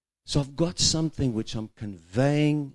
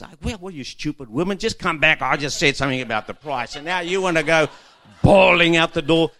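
scolding husband; 7 semitones higher, 12 dB louder than usual. Retold or imitated. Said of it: "you can go into this".

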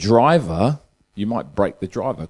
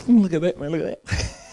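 amazed onlookers; 9 semitones higher than usual. Acted. Amazed golfers in the joke.

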